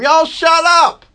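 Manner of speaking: high, stretched-out slow holler; holler at the birds. Retold or imitated. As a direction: yell it long and high at noisy birds. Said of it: "I want to holler at them".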